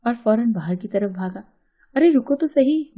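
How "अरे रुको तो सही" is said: higher, louder call to someone running off; a quick raised call after a man hurrying out; calling after him. Retold or imitated. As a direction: call out to stop him from leaving. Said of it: "मैं उसे पीछे से पुकारती रह गई".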